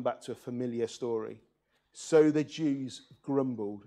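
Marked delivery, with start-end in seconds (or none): 1.9-3.8 slow, deliberate storyteller read of "grumbled"; savoring the word. Shown as a demonstration. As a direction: relish "grumbled", take it slow. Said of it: "That's a great word".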